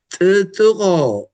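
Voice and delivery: ጥጥቆ slow and loud with a hard ቆ; wrong pronunciation. Shown as a demonstration. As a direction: stretch ጥጥቆ slowly, the wrong way. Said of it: "We never say".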